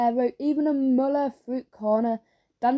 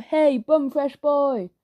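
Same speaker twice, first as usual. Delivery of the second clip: drawn-out mocking playground shout, raised; 4 semitones higher, 4 dB louder, much slower than usual. A narrator's reading. Someone in the school heckles Joe, shout. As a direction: call it out slowly, higher, across a crowd. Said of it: "heard someone shout".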